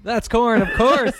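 high-pitched verdict; game show host. Pretend. Host confirming contestant's last guess, excited announcer. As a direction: declare the answer with pitch jumping up.

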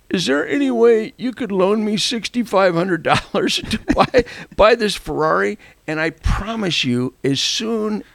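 high, wheedling request with a chuckle; mock pleading. Asking Dino for a loan, high mock pleading.

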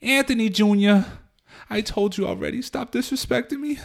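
high nagging mother voice; Carmela impression. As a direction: scold like a Jersey mom. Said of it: "fucking Carmela".

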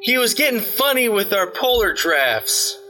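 put-on high-pitched, urgent young messenger; Leah, urgent hail. Leah asks Asuna about the runaway, urgent and high.

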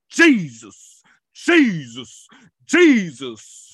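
loud, ringing cry of the name; called-out name. Acted. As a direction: call the name out so demons hear it. Calling the name demons fear.

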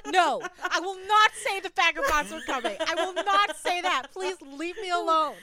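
high, loud, prim refusal; mock indignant refusal. Refusing the stranger's chant, loud mock indignation.

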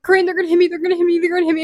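high, loud repeated cry; panicked pleading. Retold or imitated. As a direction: beg her friend on the phone in panic. Retelling panic to friend.

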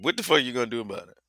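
ordinary talk

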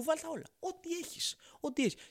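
squealing high order. Retold or imitated. Fussy café customer squealing for every topping. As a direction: squeal like a greedy kid ordering.

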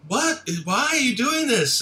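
high, indignant mock shriek; high-pitched mock disbelief; shocked outrage. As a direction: act stunned and betrayed.

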